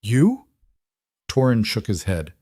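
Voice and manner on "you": a sharp, higher "You?" in scornful disbelief; harsh disbelief. A narrator's reading. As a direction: scoff the word as if it were absurd. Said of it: "harsh and disbelieving".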